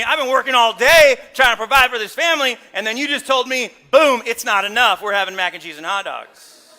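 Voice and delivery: loud indignant husband ranting about dinner; retold angry outburst, played big. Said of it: "when they put mac and cheese".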